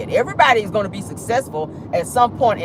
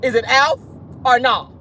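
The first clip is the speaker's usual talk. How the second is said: usual speaking voice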